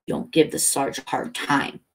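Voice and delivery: ordinary delivery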